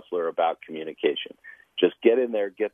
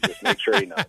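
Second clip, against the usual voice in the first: teasing; 11 semitones higher than usual. Pretend. Joking aside about Ethan.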